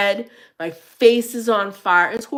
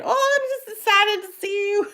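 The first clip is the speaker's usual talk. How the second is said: much higher, sing-song fake excuse; mock innocent excuse; 14 semitones higher than usual. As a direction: brush it off with a bright playful lie. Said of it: "when people are like".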